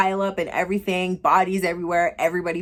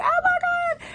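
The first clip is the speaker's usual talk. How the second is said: a shrill, much higher cry of sudden horror; shocked gasp. Retold or imitated. Character waking from a plane crash premonition, shrieking in high-pitched shock. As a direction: yelp it out like you just saw the plane blow up.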